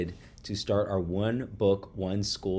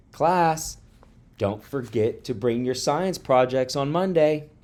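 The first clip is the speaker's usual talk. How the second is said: higher, louder teacher addressing the class; teacher announcement; 6 semitones higher, 4 dB louder than usual. A narrator's reading. Teacher reminding pupils about science projects, announcing. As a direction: call the class to attention.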